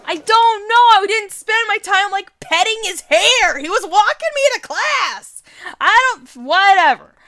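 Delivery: high, loud, flustered protest; flustered yell. Acted. Voicing the heroine, shouting back at her nosy friend, flustered. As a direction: yell it out, embarrassed and defensive.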